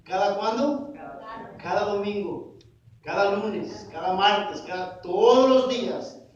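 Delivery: loud preaching list, swelling to a drawn-out finish; emphatic build. Real, not acted.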